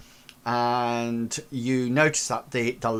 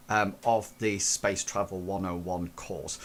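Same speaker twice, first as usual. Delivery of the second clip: ordinary talk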